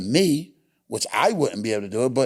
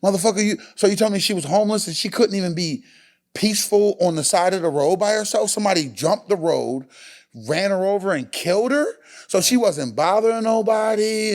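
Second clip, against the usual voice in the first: higher, heated disbelief; indignant outburst; 7 semitones higher than usual. Retold or imitated. Host retelling his anger over a woman's death, heated. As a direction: demand an answer, outraged. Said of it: "I was just like".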